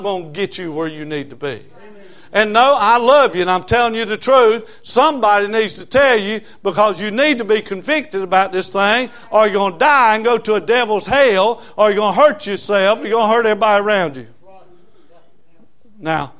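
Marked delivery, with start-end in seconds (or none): 2.3-14.3 loud, pressing sermon build; fiery preaching. Acted. Preacher warning sinners loudly out of love. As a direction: warn them hard and loud.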